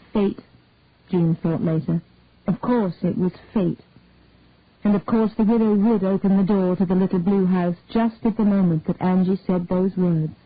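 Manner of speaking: usual speaking voice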